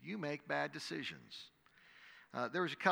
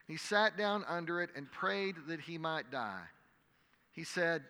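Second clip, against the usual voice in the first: measured, dramatic Bible reading to a congregation; scripture reading; 4 dB louder than usual. Acted. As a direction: read the passage aloud like a preacher. Reads the scripture passage.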